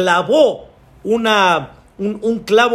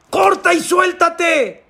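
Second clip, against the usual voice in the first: high, drawn-out calling order; insistent command; 11 semitones higher, slower than usual. Acted. God orders the clinging climber, insistent high call.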